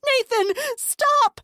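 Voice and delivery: very high, loud, slow yell; desperate yell. A narrator's reading. Narrator yelling at the brother to stop. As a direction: scream it to stop him.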